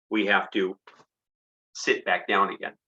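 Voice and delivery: ordinary delivery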